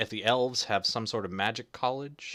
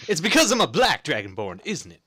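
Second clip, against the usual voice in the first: mock offended; 7 semitones higher, 11 dB louder than usual. Mock offended joke, laughing.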